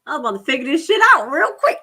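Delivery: usual speaking voice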